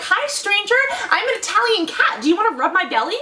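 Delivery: high, cooing cat asking for belly rubs; talking cat voice. Retold or imitated. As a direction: voice a flirty Italian street cat. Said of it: "be like".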